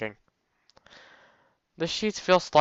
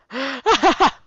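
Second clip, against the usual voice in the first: high, loud giggling cackle; burst of laughter; 8 dB louder than usual. Real, not acted. Player cracking up at their own joke, laughing.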